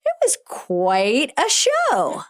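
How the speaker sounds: "quite" stretched slow and high; emphatic "quite". Shown as a demonstration. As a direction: draw out "quite" in high praise. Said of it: "Like, we say".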